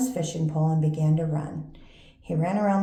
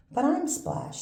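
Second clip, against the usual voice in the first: little frog voice; 10 semitones higher than usual. Acted. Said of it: "said the frog".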